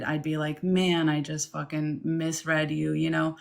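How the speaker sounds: usual speaking voice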